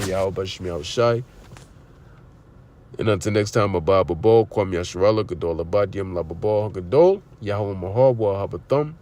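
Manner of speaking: preacher closing the lesson with a quiet Hebrew blessing; closing prayer. Acted. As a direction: wind down into a quiet benediction.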